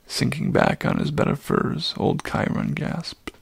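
usual speaking voice

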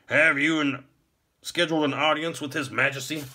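high, stiff guard's questioning; officious gatekeeper. A narrator's reading. Stiff gate warden questions visitor.

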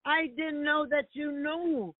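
slow, drawn-out acted plea; pleading disciple. Disciple begs Master to forgive him, slow pleading. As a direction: lightly beg your master for mercy.

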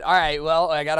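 ordinary talk, nothing marked

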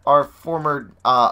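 usual speaking voice

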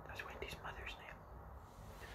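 very soft, slow whisper; hushed, voiceless explanation; whispered aside. Real, not acted. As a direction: whisper a secret note to the listener.

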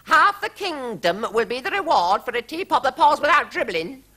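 high, rising royal decree; queen's proclamation quoted. A narrator's reading. Narrator quoting the queen's reward, high queenly voice. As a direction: repeat her decree grandly. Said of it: "the Queen said".

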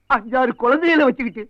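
hammed-up lament for a suffering friend; tearful. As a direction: let the last word break into a comic sob. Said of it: "அழுகையா வருது".

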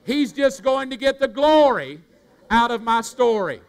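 glory cried out high and loud; loud preaching shout. Shown as a demonstration. Preacher rallies the congregation, loud and high. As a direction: preach it full voice to the room. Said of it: "say this".

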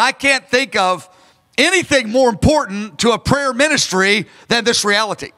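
normal talking voice

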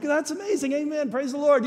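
high, bright praise; overjoyed. Retold or imitated. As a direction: cheer the news, overjoyed. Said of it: "And I'm like".

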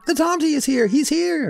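very high, excited cry; children shouting. A narrator's reading.

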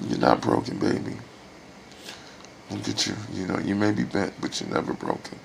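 gently imitating his aunt reassuring him, low and soft; lightly voiced loving aunt. His dear Aunt Lynn reassuring him, lightly imitated low and soft. Aunt's comforting words retold.

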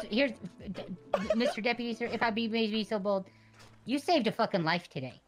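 ordinary talk, nothing marked

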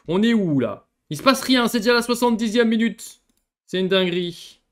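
high, loud exasperated outburst; loud disbelieving complaint; exasperated rant. Real, not acted. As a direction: vent at the dull match.

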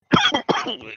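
sudden coughing fit from a wrong swallow; choking cough. Real, not acted. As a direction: cough hard like a drink went down wrong. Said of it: "I choked on my".

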